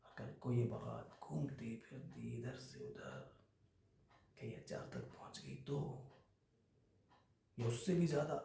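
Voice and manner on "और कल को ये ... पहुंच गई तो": much softer, almost whispered in places; hushed veiled threat. Acted. Employee warning boss that secrets could reach HR, hushed.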